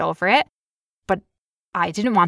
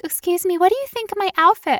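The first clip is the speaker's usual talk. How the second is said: much higher, small, unsure voice; timid asking voice; 11 semitones higher than usual. Retold or imitated. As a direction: ask a stranger shyly for approval, pitched up high. Asking shoppers about her outfit.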